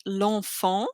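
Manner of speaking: L'ENFANT as one word, vowel of LE dropped; L straight into the nasal vowel of "L'enfant"; elided French article. Shown as a demonstration. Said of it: "you connect the other word".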